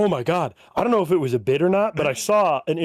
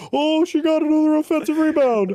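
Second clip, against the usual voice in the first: straining announcer; 11 semitones higher than usual. Retold or imitated. Announcers trying to make it entertaining, mock-excited commentary. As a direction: hype up a dull play like a desperate commentator. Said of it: "announcers are just trying to make it entertaining".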